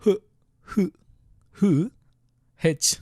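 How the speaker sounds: "h" as a short breathy "huh"; letter sound demo. Shown as a demonstration. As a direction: puff out "h" alone, repeated with pauses. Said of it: "so this is".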